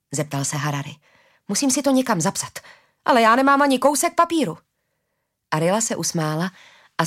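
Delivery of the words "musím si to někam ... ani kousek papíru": flustered excitement. A narrator's reading. Harari frantically searches for paper.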